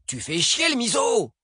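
very high, drawn-out curse; exasperated outburst. Acted. Driver curses his partner while forced to stop, exasperated outburst. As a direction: squawk in frustrated protest.

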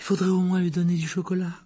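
high-pitched prescribing matron; fussy nurse. A narrator's reading. Nurse examining the boy's pupils, fussy and high. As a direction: voice a nurse insisting, pitched well up.

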